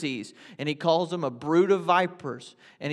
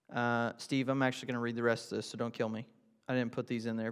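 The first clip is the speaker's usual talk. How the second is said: softer, quick aside to a friend; quiet aside; 4 semitones lower, 7 dB quieter, faster than usual. Real, not acted. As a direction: drop the voice for a side remark. Aside to Steve before reading.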